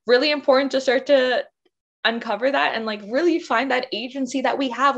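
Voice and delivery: usual speaking voice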